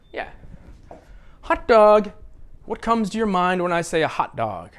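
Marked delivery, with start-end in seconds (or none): 1.4-2.1 loud, high "hot", falling "dog"; HOT dog, punched; stress on HOT. Shown as a demonstration. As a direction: call out hot dog as one word, hot first. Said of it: "You stress the first word as if".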